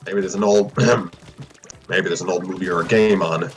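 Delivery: low, gentle fatherly reply. Acted. Fred gently suggests a movie to his son, gentle and low. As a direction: soften it for your injured son. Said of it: "Fred replied gently".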